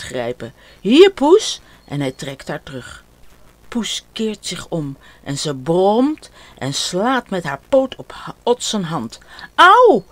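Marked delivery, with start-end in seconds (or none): 0.8-1.6 put-on much louder, higher child's call. Ot grabbing his cat's tail, loud call. Ot grabs the fleeing cat. 9.5-10.0 sharp, much higher and louder ouch; cry of pain. Acted. Ot scratched by his cat, sharp yelp.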